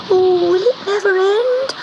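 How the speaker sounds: high, pleading little kitten voice; a small cat wailing at the storm; frightened kitten. Acted. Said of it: "thought Gobelino".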